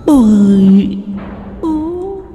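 high, drawn-out, echoing ghostly call; ghostly daughter-in-law. Acted. Dead daughter-in-law calling father-in-law to join her, ghostly voice. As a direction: beckon from the beyond in long high notes. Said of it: "vang lên tiếng cô con dâu".

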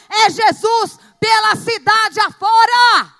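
loud preaching, rising to a shout; full-force preaching. Real, not acted. Preacher urges the church to fill Formiga, shouting. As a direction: drive it home to the crowd, shouting.

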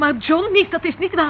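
indignant denial. Acted. Girl snaps back at her uncle defending John, loud and indignant. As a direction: fiercely defend him.

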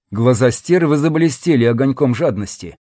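plain reading voice

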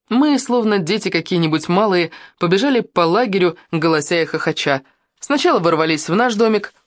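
ordinary delivery, nothing marked

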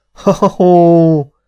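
high, loud acted "ha-ha-ha"; loud cackling laughter. A narrator's reading. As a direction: cackle out high, drawing out the last ha. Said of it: "A szél is kacagott".

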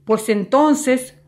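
slow, loud, high roar; roaring threat. A narrator's reading. Angry salesman threatening Bartolo, slow roar. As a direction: roar it slowly like a furnace mouth. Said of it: "rugió con su gran boca negra de horno".

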